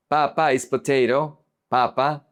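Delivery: Papa stressed PA-pa; first-syllable stress. Shown as a demonstration. Teacher explaining potato versus dad, Papa stressed first. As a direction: put the weight on the first pa of Papa. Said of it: "If you change the emphasis".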